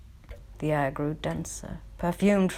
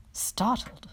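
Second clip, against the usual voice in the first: ordinary delivery, nothing marked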